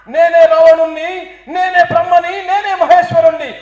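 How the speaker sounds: slow, loud thundering boast; booming Ravana. Acted. Ravana proclaiming his greatness to the TV reporter, slow and thundering. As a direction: proclaim yourself like a mighty demon king.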